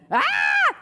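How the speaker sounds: short, piercing, loud shriek; scream. A narrator's reading. Margaret sees a monstrous creature, high scream. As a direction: let out a high fright scream. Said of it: "screamed Margaret".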